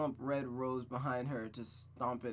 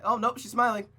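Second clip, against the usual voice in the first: surprised reaction; 9 semitones higher, 10 dB louder than usual. Real, not acted. Reacts to comic panel.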